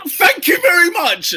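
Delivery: mock thanks. Real, not acted. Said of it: "mock gratitude after point won".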